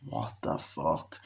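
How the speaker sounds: slow, whispered bewilderment; hushed disbelief. Retold or imitated. Man baffled after the nightmare, slow whisper. Acts bewildered man's reaction.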